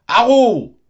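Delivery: a huge high yell for help; full-throated cry. Acted. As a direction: shout it at the top of her lungs. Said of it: "à pleine gorge".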